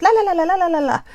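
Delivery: la la babble showing how fast she talks when nervous. Shown as a demonstration. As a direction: rattle it off like nervous fast talk. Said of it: "I talk really fast".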